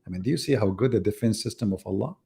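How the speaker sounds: ordinary delivery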